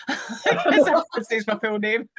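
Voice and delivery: words breaking into real laughter; cracking up at your own story; laughing. Real, not acted.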